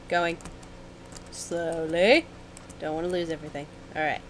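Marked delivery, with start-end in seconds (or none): none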